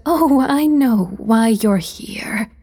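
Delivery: airy giggly whisper, slow; giggling. A narrator's reading. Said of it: "she giggled".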